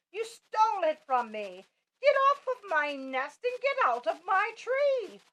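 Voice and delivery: high, loud furious squawk; angry bird. A narrator's reading. Maisie shouting Horton off her nest, furious. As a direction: drive him off the nest.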